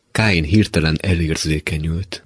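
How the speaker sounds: ordinary delivery, nothing marked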